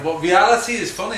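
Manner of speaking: ordinary delivery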